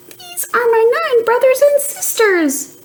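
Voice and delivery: a tiny fish piping up; high fish voice. Acted. A babbling fish introduces his siblings in a high squeaky voice.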